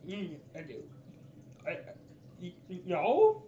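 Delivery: halting, stammered creature voice; stuttering lizard man. Acted. Lizard man answering a nosy intruder, nervous stammer. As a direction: trip over the first sound, then drag it out. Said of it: "what the fuck is up with people stuttering here".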